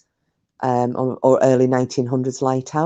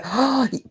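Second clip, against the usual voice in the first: gasp; 10 semitones higher than usual. Real, not acted. Remembering a favourite song.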